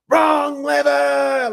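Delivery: high, slow, loud shout of the line; shouted movie quote. Retold or imitated. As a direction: bellow it like the villainess losing her temper. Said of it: "what's it?".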